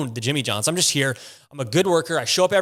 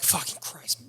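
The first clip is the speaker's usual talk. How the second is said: muttered curse; 10 dB quieter, slower than usual. Retold or imitated. Muttering after car hit him.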